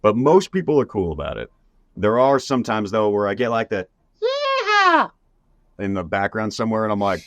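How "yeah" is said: very high, long cowboy whoop; imitated yeehaw holler. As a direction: whoop like a fan hollering across the room. Imitated yeehaw from a background stranger.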